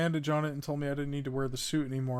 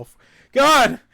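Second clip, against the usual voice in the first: sudden loud high cry of fright; startled by a game monster, yelping loudly; 10 semitones higher, 15 dB louder than usual. Real, not acted. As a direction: jump scare yell. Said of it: "just scared the shit out of me".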